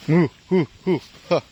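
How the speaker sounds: high, loud huffing puffs; real puffing from exertion; out-of-breath panting. Real, not acted. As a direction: huff out short breaths, winded from clearing branches. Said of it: "Hinihingal ako".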